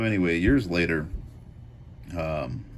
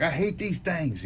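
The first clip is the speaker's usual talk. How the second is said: mock whine; 10 semitones higher than usual. Retelling friend swatting cicadas.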